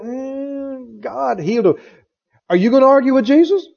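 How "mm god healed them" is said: softer, hesitant mock voice with a hum; mock the cautious believer; doubtful hedging. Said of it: "They think".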